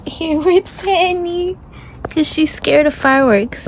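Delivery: cooing at a scared pet; baby talk to dog. Acted. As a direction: coo to the dog.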